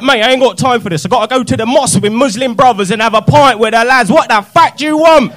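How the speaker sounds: loud, high Cockney rant; an irritated East London geezer telling off a caller; pissed-off geezer. Retold or imitated. Cockney dealer snapping at a customer on the phone. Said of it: "he'd get pissed off as well".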